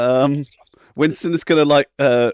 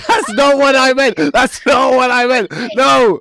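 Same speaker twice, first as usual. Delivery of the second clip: much higher, loud flustered protest; panicked shout; 12 semitones higher, 6 dB louder than usual. Acted. Winston shouts his denial at Yasmina, high and loud. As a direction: yell the denial in embarrassed panic.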